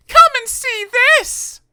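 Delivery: very high, loud call; shouting. Acted. Miss Trouble calling the crowd, very high and loud. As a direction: bellow it to the whole town. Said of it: "at the top of her voice".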